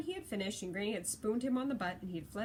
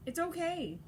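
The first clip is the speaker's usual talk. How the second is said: put-on higher-pitched boy brushing off concern; insisting; 8 semitones higher, 4 dB louder, slower than usual. A narrator's reading. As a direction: insist in a raised, childlike pitch. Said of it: "he insisted".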